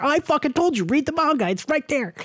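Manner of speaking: high, fast, mock-indignant I-told-you-so; half-joking told-you-so at friends; told-you exasperation. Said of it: "It's like".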